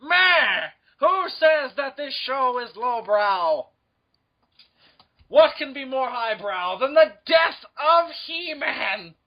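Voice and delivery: high, slow, hammed-up villain voice; grand mock indignation, drawn out; cartoon villain. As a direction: declaim it like an outraged cartoon villain. Mock villain defends the show.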